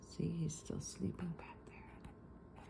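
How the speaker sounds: soft bedtime whisper aside. Real, not acted. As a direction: whisper so the bear won't wake.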